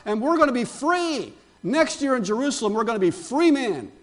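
high-pitched rallying cry, retold from the pulpit; imitated jubilant crowd. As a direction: proclaim it as a crowd hailing its deliverer. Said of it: "they're all shouting out".

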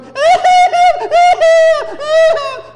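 very high, loud mock bawling; child's crying. Four-year-old sitting in the hallway, loud imitated crying. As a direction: wail like a small child in full cry.

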